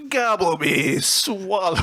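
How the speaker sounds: half-hearted silly voice, abandoned quickly; funny voice. Pretend. Comedian trying a funny voice on the lyrics, hammed-up. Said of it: "a funny voice".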